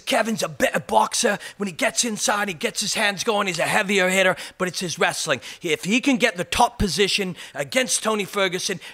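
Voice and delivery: mock English fight pundit making his pick; Michael Bisping. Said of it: "my English accent is disgusting".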